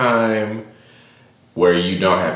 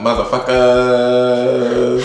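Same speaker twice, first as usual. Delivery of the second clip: slow, drawn-out higher greeting; hammed-up intro; 4 semitones higher, 4 dB louder, much slower than usual. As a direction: stretch it out like a show host.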